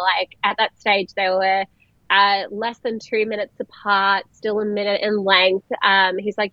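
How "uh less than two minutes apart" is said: normal talking voice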